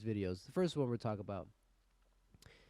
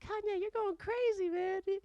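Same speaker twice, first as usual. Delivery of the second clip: very high mock-concerned falsetto; scolding internet commenter. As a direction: fret and scold in a squeaky put-on voice. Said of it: "Internet's like".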